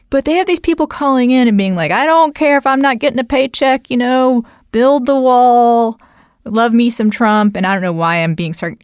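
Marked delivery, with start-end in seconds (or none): 1.9-7.6 higher, exaggerated Southern drawl, slowing right down on build the wall; mock Southern accent. Mocking federal workers who call Rush Limbaugh, hammed-up Southern accent. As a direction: lampoon a Southern Trump fan phoning in to talk radio in a Southern accent. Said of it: "being mocking of my Southern accent".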